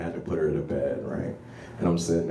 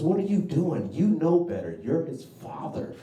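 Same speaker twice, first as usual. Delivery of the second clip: wife scolding, pitched up; imitating an upset wife, higher; mock upset wife; 10 semitones higher than usual. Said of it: "She's upset".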